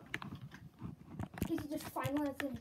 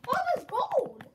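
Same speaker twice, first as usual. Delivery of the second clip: excited shout; 10 semitones higher, 11 dB louder, slower than usual. Real, not acted. Finds gold while mining.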